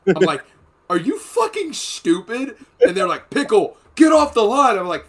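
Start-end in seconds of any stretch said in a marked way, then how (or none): 0.9-2.6 high, loud, slowed-down berating; retold incredulous outburst. Marine berating the man who shot himself, incredulous. 3.3-4.8 high, loud command; imitated yelled order. Instructors ordering Pickle away, imitated yell. Instructors yelling him off the line.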